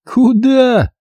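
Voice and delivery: high indignant where. A narrator's reading. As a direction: bristle with outrage. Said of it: "возмутился Мамай".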